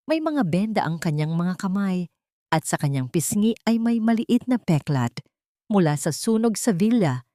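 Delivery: usual speaking voice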